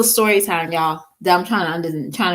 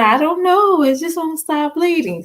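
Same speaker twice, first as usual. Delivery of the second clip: imitating her son; 9 semitones higher than usual. Mom voices her son telling her about the bleeding, higher and worried. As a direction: mimic the scared boy explaining. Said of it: "He said".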